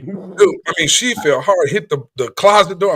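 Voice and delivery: ordinary talk, nothing marked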